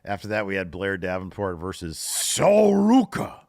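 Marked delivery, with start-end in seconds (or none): 2.0-3.4 playful over-the-top ring-announcer call; announcer shout. Pretend. Introducing the wrestler in the match recap, playful announcer shout. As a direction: belt out the name, high and drawn-out.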